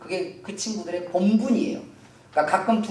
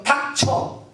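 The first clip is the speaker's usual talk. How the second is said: acted angry crowd telling someone off; high, punchy hushing demands; scolding shouts; 6 semitones higher than usual. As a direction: rebuke building higher in pitch. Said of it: "사람이 막 소리를 지른 거예요".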